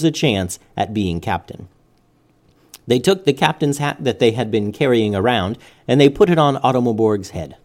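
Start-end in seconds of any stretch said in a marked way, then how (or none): none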